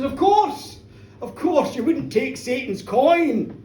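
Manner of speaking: loud, emphatic declaration in her defence; indignant reassurance. A narrator's reading. Minister defending Janet against Satan's coin rumour, loud and emphatic. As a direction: dismiss the charge out loud, stretching the end.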